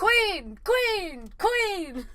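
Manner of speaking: high repeated mock cheer; imitated screaming. Co-host joins the screaming impression, high echoing cries. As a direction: echo it high, each cry ringing out. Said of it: "show him screaming".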